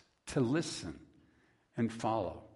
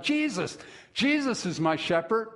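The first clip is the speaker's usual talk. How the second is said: put-on high, eager child's cry; boy crying out; 8 semitones higher than usual. Small boy answering the pastor, crying out high. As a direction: blurt it out like an eager little boy. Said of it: "a small boy cries out".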